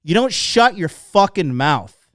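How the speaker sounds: loud, slow telling-off; emphatic scolding. Real, not acted. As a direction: scold the listeners, weighting each word. Scolding listeners for interrupting.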